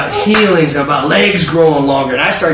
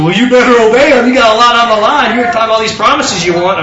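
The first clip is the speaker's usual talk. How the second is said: higher, louder, imitating her laughing scolding; teasing, amused retelling; 5 semitones higher, 4 dB louder than usual. Friend laughs at his reluctance to go to the gym, teasing. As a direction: tease like a friend who finds it funny. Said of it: "She starts laughing".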